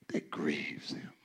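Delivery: whispered, barely any voice. Real, not acted. Preacher hushes the church to wake them, whisper. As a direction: drop to a breathy hush. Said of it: "whispered for effect".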